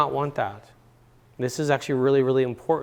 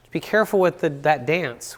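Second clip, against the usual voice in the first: usual speaking voice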